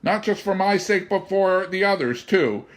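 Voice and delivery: high, loud, urgent begging; pleading. A narrator's reading. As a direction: beg him desperately to be changed back. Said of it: "George pleaded".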